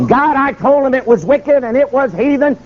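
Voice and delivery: high-pitched calling out to God; imitated loud declaration. Preacher testifying to God before governor, imitated proclaiming. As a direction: testify aloud so bystanders gather round. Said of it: "he's talking so loud".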